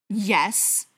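loud, snarled yes; angry, forceful reply; snarling. A narrator's reading. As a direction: snarl the answer. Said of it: "Briar snarled".

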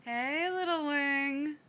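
doting big brother cooing. A narrator's reading. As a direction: coo it gently, high and drawn out. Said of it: "a voice coos".

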